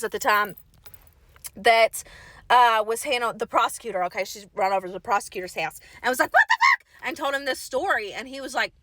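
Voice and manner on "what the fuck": a much higher, louder shouted question; frantic shout. Retold or imitated. Mother yells at the prosecutor's door, shrill frantic shout. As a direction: burst in yelling, wild-eyed.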